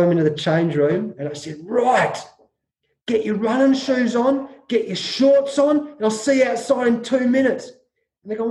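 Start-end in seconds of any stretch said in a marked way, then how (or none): 1.6-7.7 higher-pitched, stern barked orders; a retold coach laying down the law. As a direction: bark orders at the hungover players. Coach orders hungover players.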